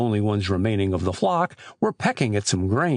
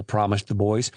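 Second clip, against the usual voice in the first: ordinary delivery, nothing marked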